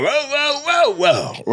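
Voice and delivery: first whoa jumps high, then drops back; mock dog sound effect, same beat; dog howl in cadence. Comic imitating Rico doing a dog sound effect. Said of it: "So he's like".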